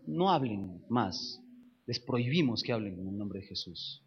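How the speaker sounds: stern low warning. A narrator's reading. Judges forbidding the apostles to speak, stern and low. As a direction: lay down the prohibition heavily.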